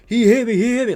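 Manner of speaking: mock whine. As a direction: imitate a flopping player begging for the call. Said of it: "looking up around like".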